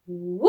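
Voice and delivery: rising woo as the ball flies up; ball whoosh. Shown as a demonstration. Teacher showing the class a ball sound, rising woo. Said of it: "the noise and the sound that it would make".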